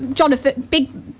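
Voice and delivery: usual speaking voice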